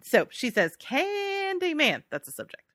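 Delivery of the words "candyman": drawn-out, high announcement of the title; dramatic title read. Pretend. Reading the letter's subject line as a slow, high title. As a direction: stretch the word like a movie title.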